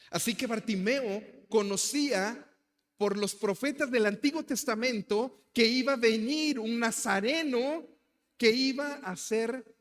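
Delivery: high, loud sermon delivery; fiery preaching. Acted. Pastor preaching to the congregation, loud and fervent. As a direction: preach it to the back row.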